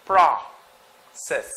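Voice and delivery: Process split into "prah", pause, "sis"; long gap between the syllables of Process; syllabicated. Shown as a demonstration. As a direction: say "Process" slowly in two separate syllables. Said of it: "Let's try to syllabicate".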